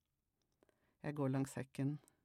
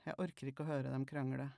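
plain reading voice

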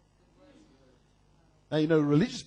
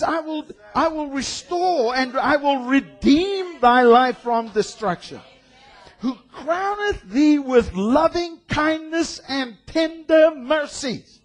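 higher, louder slow proclaiming; preached scripture; 7 semitones higher, 5 dB louder, slower than usual. Acted. Preacher to congregation, high slow proclamation. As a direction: ring out the promise slowly.

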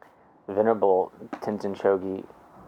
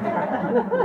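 high laugh; 14 semitones higher than usual. Real, not acted. Laughs at friend's reply.

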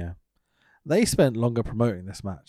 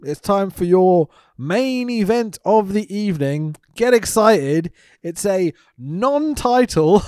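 hammed-up ring announcer, slow and a bit louder; mock announcer; 4 semitones higher, 4 dB louder than usual. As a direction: build the hype for the main event. Announcing the main event.

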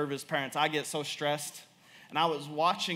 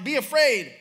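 high, loud comic plea; exasperated mock command; 7 semitones higher, 7 dB louder than usual. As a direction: cry it out like a desperate parent. Father urging fearless toddler, comically.

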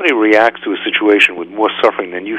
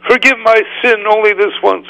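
pleading Pharaoh; 13 semitones higher, 3 dB louder than usual. Retold or imitated. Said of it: "Paro ... said to them".

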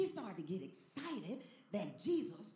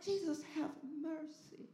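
high, slow plea; Bartimaeus crying out; 9 semitones higher, slower than usual. Acted. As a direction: draw the plea out, pitched up. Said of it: "He cried out".

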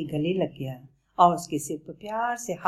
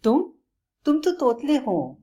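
higher, louder stammered surprise; astonished; 9 semitones higher, 4 dB louder than usual. Acted. Bablu amazed that the lion lisps, higher. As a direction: catch on the first word in amazement. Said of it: "Bablu ने आश्चर्य से कहा".

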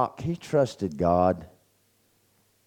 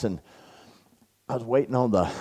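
ordinary talk, nothing marked

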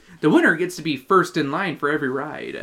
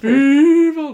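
put-on high, loud old hero yell; Mermaid Man; 9 semitones higher, 9 dB louder than usual. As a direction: shout it like a crazy old superhero. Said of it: "That's how he does in the show".

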